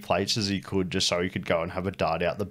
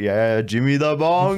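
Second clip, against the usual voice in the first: higher, louder, slow drawn-out nickname; teasing call; 6 semitones higher, 6 dB louder, slower than usual. Retold or imitated. Boss greeting the worker by nickname, slow teasing call. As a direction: drag the nickname out mockingly. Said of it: "he'd be like".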